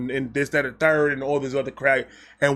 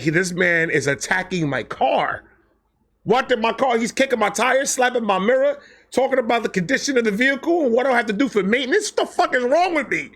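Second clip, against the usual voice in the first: higher, rushed mock girl's inner panic; 7 semitones higher than usual. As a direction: voice the girl freaking out. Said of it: "she's like".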